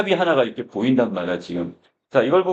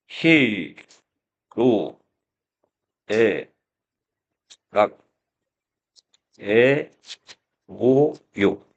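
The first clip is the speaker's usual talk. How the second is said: isolated syllables with long pauses, slightly lower; 4 semitones lower, much slower than usual. Real, not acted. Teacher writing out the seven emotions for students, one syllable at a time. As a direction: say each one slowly as you write it.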